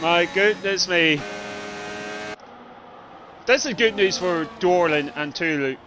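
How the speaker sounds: ordinary talk, nothing marked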